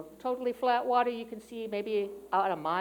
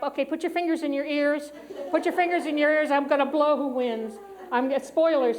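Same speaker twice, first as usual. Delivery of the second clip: louder, quicker, jokey warning to the room; mock-urgent spoiler alert; playful warning; 5 semitones higher, 6 dB louder than usual. Real, not acted. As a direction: warn them before a spoiler. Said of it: "spoiler warning to congregation".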